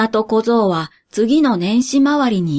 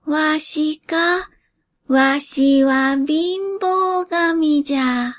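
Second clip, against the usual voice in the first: put-on slow, higher croaky old spirit; creaky wise old man; 5 semitones higher, much slower than usual. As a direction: creak it out like an ancient god of poverty. Poverty god answers.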